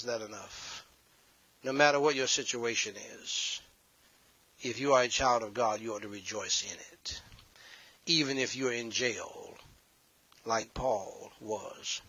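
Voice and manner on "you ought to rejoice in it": hushed, urgent sermon whisper. Real, not acted. Preacher whispering to the congregation to rejoice. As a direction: lean in and breathe it.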